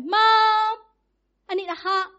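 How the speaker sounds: high, sing-song plea for a hug; kids begging. Retold or imitated. Kids ask mom for hugs and kisses, sing-song and high. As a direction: sing it out like a child wanting cuddles. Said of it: "my kids were like".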